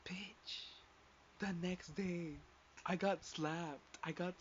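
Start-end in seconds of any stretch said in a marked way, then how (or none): 0.1-0.8 breathy whispered aside; whispered insult. Retold or imitated. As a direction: hiss it under your breath.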